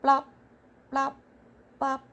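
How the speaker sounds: short popped frog noises; imitated blop, one at a time with gaps; frog's blop. A narrator's reading. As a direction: pop each one out separately. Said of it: "Then I heard a new song".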